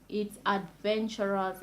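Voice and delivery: normal talking voice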